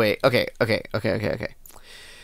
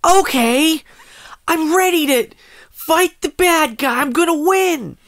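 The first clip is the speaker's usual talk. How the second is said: high cute anime voice; 11 semitones higher than usual. Pretend. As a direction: chirp it like an eager anime hero. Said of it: "Do your best anime voice".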